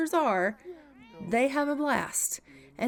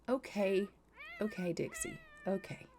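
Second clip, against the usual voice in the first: soothing; 9 dB quieter than usual. Real, not acted. Soothing the baby.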